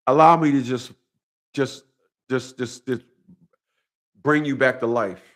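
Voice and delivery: usual speaking voice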